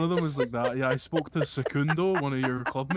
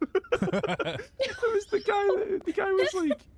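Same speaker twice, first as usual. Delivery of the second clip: laughing; 3 dB louder, much slower than usual. Real, not acted. Laughing while telling.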